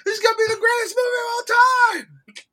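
very high, loud shouted cry of joy; ecstatic scream. Retold or imitated. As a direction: scream it like you lost your mind. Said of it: "I was screaming up and down".

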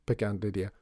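usual speaking voice

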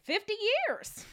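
hammed-up old-timey American accent; put-on American accent. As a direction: play an exaggerated American local. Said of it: "What was that accent?".

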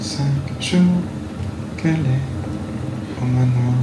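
very slow, drawn-out and eerie; slow eerie delivery. Shown as a demonstration. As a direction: stretch it out like a ghost story. Repeated slow eerie line.

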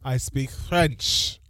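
hammed-up French accent, loud opening. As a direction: imitate a French accent, drawing words out. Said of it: "had to do a French accent".

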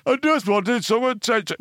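high, slow whiny pleading; mock whiny granddad. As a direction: drag it out like a pathetic old man. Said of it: "he was like".

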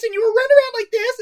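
put-on high, fast girly voice; weird girly voice. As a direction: squeal it in a silly girly voice. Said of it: "this weird girly voice".

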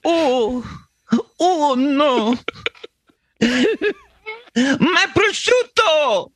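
put-on high, slow wailing Italian accent. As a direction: mourn it in a hammed-up Italian accent. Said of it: "I'm doing an accurate Italian accent".

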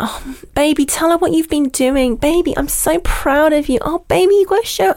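high, cooing girlfriend mocking her man; gushing baby talk. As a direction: coo it up high like a doting girlfriend. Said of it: "she was calling it, like, this baby term".

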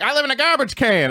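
high-pitched retold impression; imitating Chappelle. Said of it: "and I did Chappelle".

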